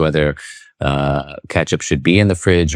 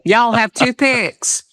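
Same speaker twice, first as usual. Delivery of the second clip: put-on much higher, slow, drawn-out Southern US accent; Southern drawl; much slower than usual. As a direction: stretch it like a diner regular. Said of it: "that's a classic".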